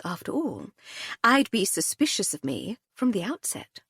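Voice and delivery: a hint of a breezy, amused aristocrat; lightly voiced Lady Hardcastle. A narrator's reading. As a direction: lightly play the unruffled lady, teasing the inspector.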